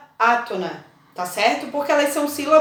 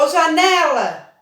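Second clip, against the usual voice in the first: drawn-out call, higher, stretching the stressed né; 4 semitones higher, 4 dB louder than usual. Pretend. Teacher pretending to call a colleague across the street, calling out. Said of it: "Aí eu vou chamar".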